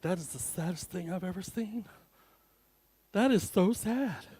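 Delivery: hushed, far-off pitying voice; a hint of a mother pitying him; pitying mom's look. Mom staring at the date, mock pity. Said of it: "I always hear, it's like".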